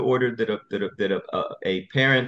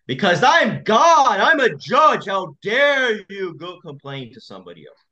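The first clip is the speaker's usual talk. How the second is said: outraged judge; 8 semitones higher, 6 dB louder than usual. Retold or imitated. Judge scolding a parent for going over his head, high and indignant. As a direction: play a pompous judge offended anyone dared complain. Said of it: "They're furious".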